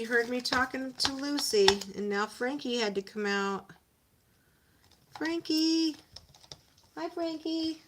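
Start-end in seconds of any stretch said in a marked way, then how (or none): 5.2-7.8 high sing-song greeting; pet voice. Real, not acted. Owner greets her dog Frankie, high pet voice.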